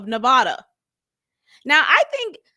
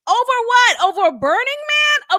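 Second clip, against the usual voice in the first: incredulous; 10 semitones higher, 6 dB louder than usual. Real, not acted. Incredulous outburst.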